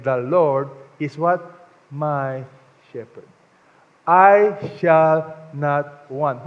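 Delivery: slow drawn-out "I shall not want"; very slow, each word weighed; call and response. Shown as a demonstration. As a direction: pause so the people fill in shepherd. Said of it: "this psalm is saying".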